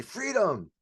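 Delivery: high, drawn cheer falling at the end; rallying cry. Shown as a demonstration. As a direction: call it out to get a crowd going. Models rallying cry to workshop.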